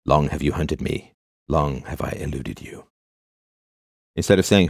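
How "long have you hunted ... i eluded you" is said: softer and slow, a grave hint of Aragorn; hushed epic drama. Mock-hero greets an old friend, hushed and grave. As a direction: murmur it like an ancient hero meeting a foe. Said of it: "have to say it that way".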